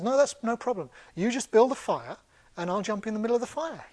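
high, cheerful reassurance; perky hare, rising towards a laugh; blithely eager hare. Acted. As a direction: offer it brightly, as if no trouble. Hare cheerfully offers to jump.

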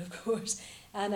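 ordinary delivery

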